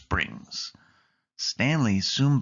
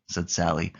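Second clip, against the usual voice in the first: usual speaking voice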